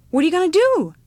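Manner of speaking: put-on high, loud child's question; eager child. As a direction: pipe up like a curious kid. Child eagerly questions old woman.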